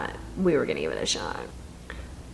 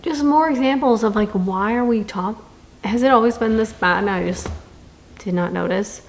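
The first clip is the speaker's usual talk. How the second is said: normal talking voice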